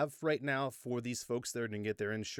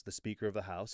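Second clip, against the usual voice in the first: usual speaking voice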